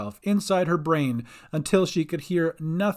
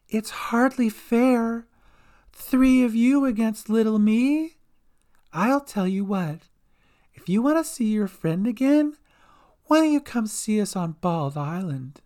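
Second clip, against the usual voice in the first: Dolly; 7 semitones higher than usual. A narrator's reading. Monstrous Dolly bargains over her captive, taunting villain. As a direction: toy with them, pitched up.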